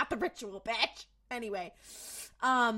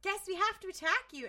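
higher-pitched creepy mock voice; small, high, creepy villain impression; creepy little voices; 7 semitones higher than usual. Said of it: "in their, like, creepy little voices".

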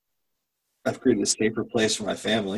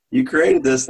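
wife teasing smile; 4 semitones higher, 5 dB louder, slower than usual. Retold or imitated. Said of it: "my wife just smiles and just like".

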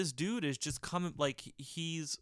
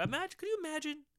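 mock high boss voice; 12 semitones higher than usual. Mocking boss's pitch.

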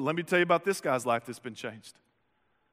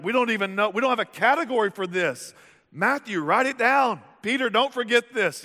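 higher, louder, hammed-up excitement; excited disciples; 7 semitones higher, 5 dB louder than usual. Disciples in the boat, telling each other to record it, excited. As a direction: playfully act out the stunned disciples.

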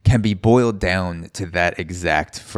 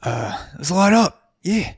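much higher, cheery stoner voice; lightly mocking eager bar crowd; eager patrons; 11 semitones higher, slower than usual. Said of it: "They're like".

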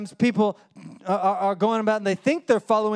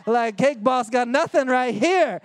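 slow, loud, swaggering brag, higher pitch; cocky boast; 6 semitones higher, 4 dB louder, slower than usual. Retold or imitated. Teen boasting that his muffins beat Cake Boss, cocky loud brag. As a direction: crow about it like a star baker. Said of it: "and I'm like".

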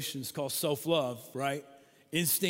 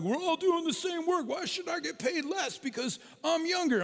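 put-on much higher, louder kid's complaint; whiny child protest; 13 semitones higher, 3 dB louder than usual. Kids protesting unequal pay to their dad, whiny high voice. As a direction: pitch it way up like an indignant kid. Kids' rebellion over allowance.

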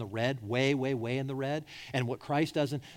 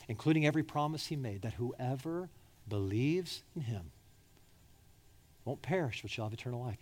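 hushed sermon voice; 3 semitones lower, 5 dB quieter than usual. Acted. Preacher hushing to the crowd, softly intimate. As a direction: drop to a near whisper, much softer.

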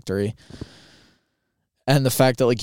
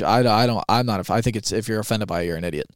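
usual speaking voice

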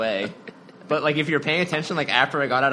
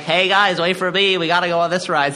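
put-on higher, louder nerdy kid voice; nasally high-pitched voice; 5 semitones higher, 6 dB louder than usual. Shown as a demonstration. Student goofing around at physics day, nasally high-pitched nerd voice. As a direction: whine it nasally, as nerdy as possible. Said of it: "like, this really nasally, like, high-pitched voice".

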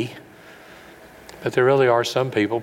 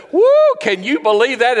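much higher, louder, thrilled whoop; whooping amazement; 14 semitones higher, 7 dB louder than usual. Retold or imitated. Shepherds leaving the manger, high loud thrilled whoop. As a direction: whoop like you just saw a miracle.

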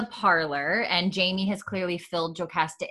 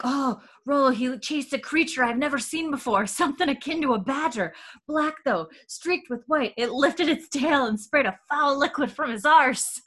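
put-on a higher, boyish Scottish lad; young lad's voice; 7 semitones higher than usual. Boy explaining the skunk to the family, higher boyish voice. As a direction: pitch up like an excited youngster. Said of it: "And Ian's like".

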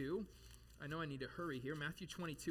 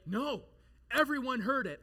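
loud, higher-pitched mock insistence; adamant literalist; 8 semitones higher, 9 dB louder than usual. Literalist disputing a figure of speech, adamant. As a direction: insist loudly like an indignant literalist. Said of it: "A literalist would say".

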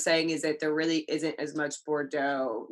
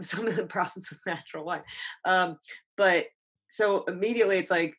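ordinary talk, nothing marked

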